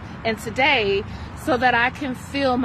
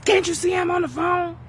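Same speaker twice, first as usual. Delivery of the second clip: much higher and quicker, an irritated shout on Can't; snapping shout; 8 semitones higher, faster than usual. Shown as a demonstration. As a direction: bark it at the kid who interrupts. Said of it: "That's reacting".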